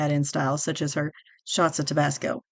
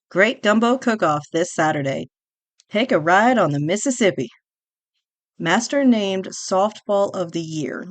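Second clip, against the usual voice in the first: louder, punchy newsboy read; headline read-out; 6 dB louder than usual. Acted. Host reading old newspaper headlines to listeners, louder and announcing. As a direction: call out each headline like a paper seller.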